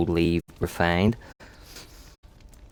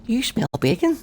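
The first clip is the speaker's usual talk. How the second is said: high, puzzled squeak of a question; sudden high bewildered ask; baffled question. Retold or imitated. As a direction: ask it like you can't believe your nose. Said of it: "I'm like".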